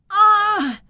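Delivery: high, loud shriek of a caught vampire; a sudden yelp as the cape is yanked; startled cry. Acted. The Count yelps as Victor grabs his cape, shrill startled cry. Count grabbed through cage bars.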